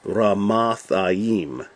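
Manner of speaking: "Ramathaim" drawn out as rah-mahth-ah-EEM; slow Hebrew-style "Ramathaim", long "eem" at the end; Hebrew pronunciation. Shown as a demonstration. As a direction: sound out "Ramathaim" very slowly with open "ah" vowels. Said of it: "And this is".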